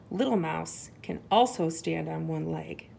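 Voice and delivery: usual speaking voice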